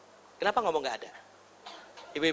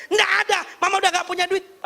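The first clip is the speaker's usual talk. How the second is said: high, scolding refusal; angry mother; 8 semitones higher than usual. Acted. Mother refuses child candy money, angry mock scolding. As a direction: shut the child down like a cross mum. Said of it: "mamanya marah-marah bilang".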